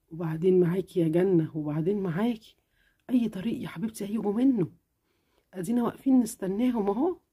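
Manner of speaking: mother calming her restless daughter, lightly exasperated; mildly exasperated mother. Acted. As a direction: sigh her name, patient but tired.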